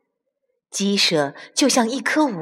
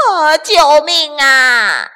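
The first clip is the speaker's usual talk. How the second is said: loud slow panicked scream; cry for help; 5 semitones higher, 7 dB louder, slower than usual. Acted. Weasel screaming for help in the quicksand. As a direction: scream for rescue.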